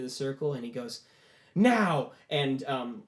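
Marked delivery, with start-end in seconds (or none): 1.5-2.1 sudden high, loud burst; shouted command. Acted. Boy pushing power into the circle, shouted command. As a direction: fire off one loud order.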